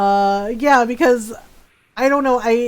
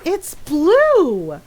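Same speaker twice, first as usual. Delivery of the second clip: exasperated insistence; 5 semitones higher, 4 dB louder than usual. Retold or imitated. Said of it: "And it's like".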